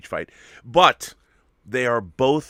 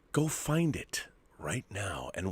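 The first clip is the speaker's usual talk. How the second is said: hushed unvoiced urging; whispered plug for a documentary; 3 semitones lower, 8 dB quieter, slower than usual. Real, not acted. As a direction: whisper it like a secret tip. Urging viewers.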